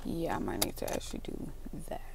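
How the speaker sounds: soft unvoiced whisper. Real, not acted. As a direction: whisper it to yourself. Thinking it over to herself.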